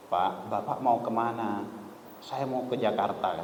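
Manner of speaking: softly, slowly, almost a whisper; whispered aside. Retold or imitated. A man quietly whispering to the stubborn passenger. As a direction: lean in and murmur it slowly.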